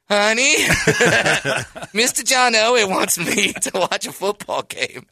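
barely containing glee while telling wife; giddy laughing. Retold or imitated. Giddy call to pregnant wife.

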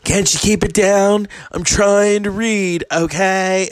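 prissy mock complaint. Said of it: "[name] stick his head".

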